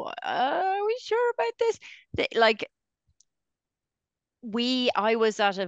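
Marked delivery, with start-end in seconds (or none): 0.0-1.8 much higher, hesitant doubting director; lightly voiced director having second thoughts; doubtful director. The director questioning a casting choice, high doubtful. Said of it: "the director not to go".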